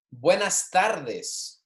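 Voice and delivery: Buenas tardes drawn out, each syllable slow; very slow model. Shown as a demonstration. Teacher to class, very slow buenas tardes to repeat. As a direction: stretch every sound for the class. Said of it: "saying these with me".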